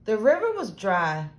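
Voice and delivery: plain reading voice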